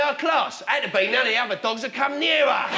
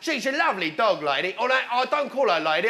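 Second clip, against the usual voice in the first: ordinary delivery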